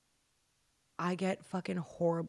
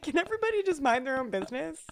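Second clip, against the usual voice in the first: higher, fast, mock indignant; huffy rant at the family; exasperated fast retort, higher pitch; 9 semitones higher, 3 dB louder, faster than usual. Retorting to household.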